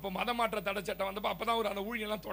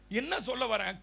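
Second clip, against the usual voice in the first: ordinary talk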